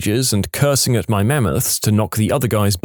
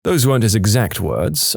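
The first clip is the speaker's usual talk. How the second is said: ordinary talk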